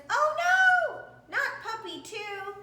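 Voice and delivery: very high, loud cry of dismay. Acted. Family seeing the puppy run away, dismayed cry. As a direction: shriek at the bad news.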